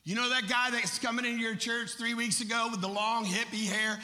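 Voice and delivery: rapid-fire preacher rolling through a list; full-force preaching. Acted. Preacher confronting pastors about the long-haired visitor, full-force preaching. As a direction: preach it loudly to the room.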